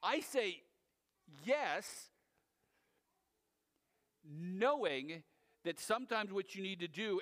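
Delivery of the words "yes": usual speaking voice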